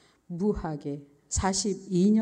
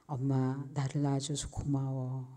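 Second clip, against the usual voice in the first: lower, softly voiced gratitude; tender thanks; 4 semitones lower, 4 dB quieter than usual. Daughter silently thanking mother for birth, tender and hushed. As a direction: let the thanks rise quietly from inside. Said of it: "라는 말이 이렇게 쑥 올라왔습니다".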